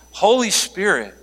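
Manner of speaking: ordinary delivery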